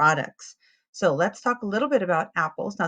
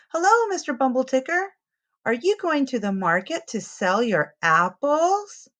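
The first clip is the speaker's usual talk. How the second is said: put-on higher, louder child's greeting shouted across the yard; a boy hailing the driver, a bit higher and louder; child calling out; 6 semitones higher, 3 dB louder than usual. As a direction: call out cheerfully to the passing truck. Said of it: "He calls out".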